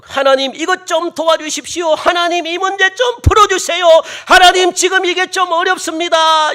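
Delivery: loud, high, urgent pleading; acted desperate shouted petition; earnest crying prayer. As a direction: beg God at the top of the voice. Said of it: "간절하게 기도를 해야 되는".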